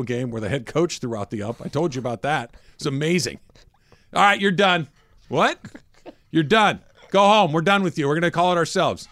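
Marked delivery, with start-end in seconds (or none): 4.1-4.9 mock ejection shout. Coach throws out the ump. 5.3-5.6 high, shocked what; can't believe he's tossed; stunned what. Retold or imitated. Umpire stunned by ejection. 6.3-9.1 mock ejection shout. Coach throws out the ump.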